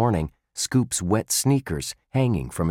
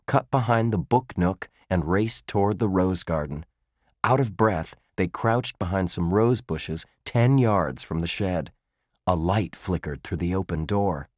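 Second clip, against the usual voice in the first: plain reading voice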